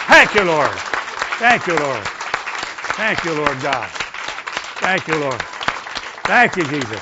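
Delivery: loud, repeated thanks in prayer; fervent praise. Real, not acted. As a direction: call out thanks, easing off from a shout. Praise after congregational shout.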